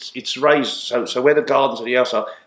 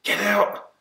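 mock anguished cry; 10 semitones higher than usual. Brothers react to the goal, high mock cry. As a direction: cry out as the ball goes in, held back.

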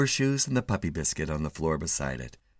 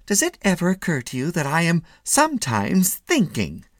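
high-pitched, pointed protest; indignant husband; 9 semitones higher, 3 dB louder than usual. A narrator's reading. Husband standing up to his wife, indignant. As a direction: push back in a raised pitch.

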